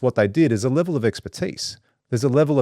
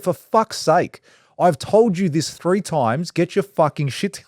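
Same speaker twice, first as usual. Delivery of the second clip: exasperated, raised and slow; cracked it; 8 semitones higher, slower than usual. Retold or imitated. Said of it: "I actually cracked it at it".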